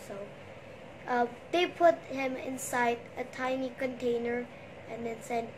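plain reading voice

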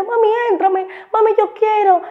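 put-on very high little-girl plea; niña pidiendo. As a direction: imitate a small child begging Mom, high and insistent. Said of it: "la niña, yo veía que cuando". (vocecita de niña rogándole a su mamá)

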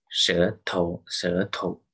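舌头 with a light, unstressed 头; full 舌, then short 头; neutral-tone demo. Shown as a demonstration. Teacher repeats an example, 舌头 with 头 in neutral tone. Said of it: "有三种neutral tone啊".

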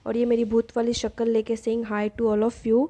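normal talking voice

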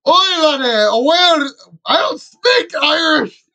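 high, loud Scottish accent with a mock cry; a hammed-up Scot wailing, Scottish accent. Gamer hamming it up in-game, Scottish accent. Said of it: "he went full Scottish".

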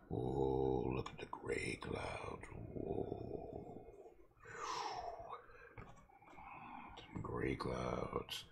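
soft breathy whisper of wonder, slow; awed whisper. Real, not acted. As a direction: hush it like sharing a secret about the clouds. Marvelling at the painted sky.

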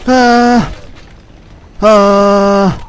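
sharp high cries of pain; pained yelps. Real, not acted. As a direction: yelp out loud, hurt. Hit by enemy, crying out.

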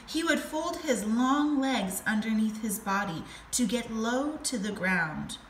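usual speaking voice

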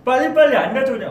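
fast, high, loud demanding chef; quoting a drama line. Retold or imitated. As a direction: bark it like an impatient head chef. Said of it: "파스타에서 나오는 대사를 제가 인용".

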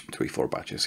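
unvoiced whisper to herself while counting; whispered aside. Real, not acted. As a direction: whisper the correction under your breath. Muttered self-correction aside.

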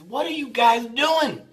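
high, drawn-out puzzled question; puzzled wife. Retold or imitated. Wife comes upstairs to the noise, high bewildered question.

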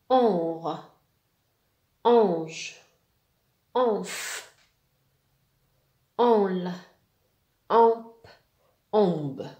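"Onre", "Onf", "Onle" as a long nasal "on" closed by a consonant; loud, isolated syllables with the vowel through the nose; nasal vowel drill. Shown as a demonstration. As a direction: sound out each nasal syllable firmly. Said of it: "ننطقوها باستعمال الأنف".

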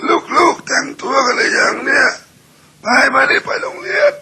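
very high, loud, slow cracked rasp; hoarse husband voice. Shown as a demonstration. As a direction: call the kids in a broken hoarse screech. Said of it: "เสียงมันอย่างเงี้ย". (ผัวเสียงแหบเรียกลูกไปโรงเรียน)

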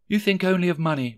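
high, quick reproach; Belle's voice. A narrator's reading. Belle reproaches young Rishi, high and quick. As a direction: reproach him as the girlfriend.

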